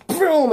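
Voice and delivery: rolled, drawn-out explosion sound; shock wave boom. Acted. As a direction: burst out "boom" like a blast wave. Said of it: "'cause Electro goes".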